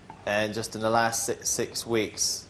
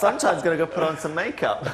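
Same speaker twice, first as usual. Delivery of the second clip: impression while laughing; 7 semitones higher, 6 dB louder than usual. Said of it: "I'm gonna do an impression".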